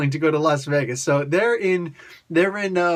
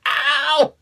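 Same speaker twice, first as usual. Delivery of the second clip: high, louder hammed-up "ow"; mock pained cry; 11 semitones higher, 4 dB louder than usual. Blade lying under Thor's hammer, mock cry of pain.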